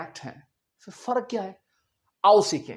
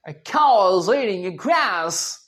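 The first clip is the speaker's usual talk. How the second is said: slow drawn-out American example; exaggerated slow American English; American accent; much slower than usual. Shown as a demonstration. Teacher giving a grass example, slow American accent. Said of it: "American English में क्या बोल देंगे".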